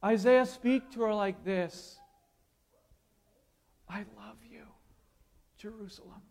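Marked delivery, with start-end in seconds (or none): 3.9-6.2 much softer, tender lover's whisper; God speaking romantically to Jerusalem, barely audible; romantic hush. Acted. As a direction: murmur it like a man to the woman he loves. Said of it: "like a man speaks to a woman romantically".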